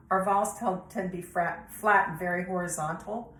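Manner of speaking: ordinary delivery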